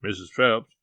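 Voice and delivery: plain reading voice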